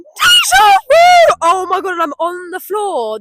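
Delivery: startled by the deja vu; shocked shout. Real, not acted. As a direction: gasp then a high loud shout.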